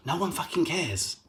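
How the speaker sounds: ordinary talk, nothing marked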